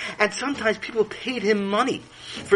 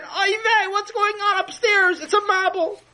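alarmed Chaim. Acted. Chaim to the house, alarmed by rain on his masterpiece. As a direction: squeal it like a boy seeing his picture flood. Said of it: "And he said".

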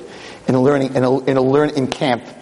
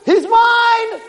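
gloating Satan; much slower than usual. Acted. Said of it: "the Satan, says".

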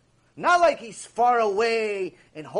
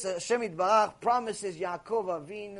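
usual speaking voice

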